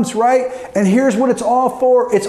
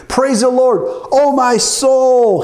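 raised, ringing verse with a drawn-out "soul"; declaimed psalm; 2 semitones higher, slower than usual. Acted. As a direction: cry out the verse, stretching the last word.

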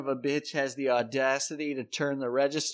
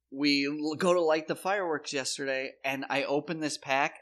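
ordinary delivery

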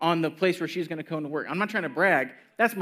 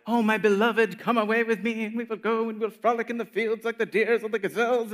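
high, swooning lover's voice; romantic poet; 7 semitones higher, 3 dB louder than usual. Retold or imitated. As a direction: ham up the lovesick poet. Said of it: "It's about joy from relationship".

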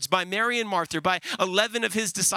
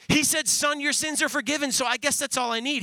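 lightly voiced, higher-pitched answer; forgiven man's answer; 6 semitones higher than usual. Forgiven man answering at heaven's gate, lightly acted and higher.

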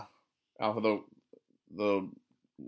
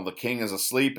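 ordinary delivery

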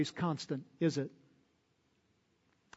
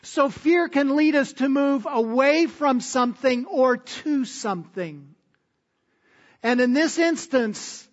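louder and higher, driving preacher's cadence; fervent pulpit delivery, pitched up and loud; preaching at full force; 7 semitones higher, 6 dB louder than usual. Acted. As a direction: preach it hard, pressing each phrase. Opening of the sermon, forceful.

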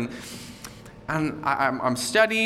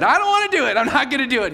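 high, loud whiny protest; childish refusal; 9 semitones higher, 5 dB louder, faster than usual. Retold or imitated. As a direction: dig in your heels like a stubborn kid. Stubborn refusal to the Spirit.

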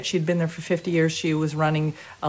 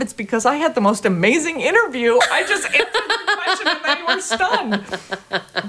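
laughing brag; 7 semitones higher than usual. Retold or imitated. Dancer telling her friend Michael about the interview, laughing. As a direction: gloat through laughter.

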